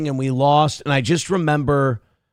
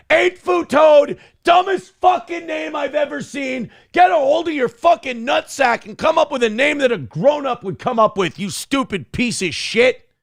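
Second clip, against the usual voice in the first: mock angry shout; 10 semitones higher than usual. Streamer insulting a chat viewer on request, mock furious shout. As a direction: yell it down like a furious coach. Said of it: "I need to get [name] to call me a piece of shit".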